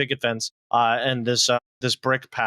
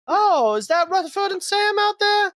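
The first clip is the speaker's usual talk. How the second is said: much higher woman's voice, surprised; fond older woman. Acted. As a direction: gush at the boys on the doorstep. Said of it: "GM voicing the hostess".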